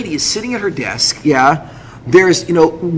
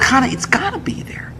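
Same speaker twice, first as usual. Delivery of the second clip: urgent whispered insistence; 7 semitones higher, 6 dB quieter than usual. Retold or imitated. Husband answering his wife about the missing paper, whispering. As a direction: whisper back in disbelief.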